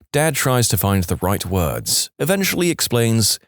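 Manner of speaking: ordinary talk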